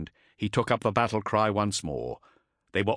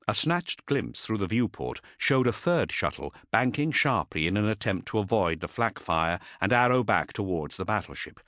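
usual speaking voice

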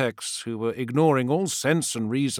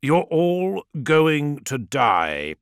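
slow, loud, barely held anger; contained anger; 5 semitones higher, 5 dB louder, much slower than usual. A narrator's reading. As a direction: hold back the rage. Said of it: "struggling to contain his anger".

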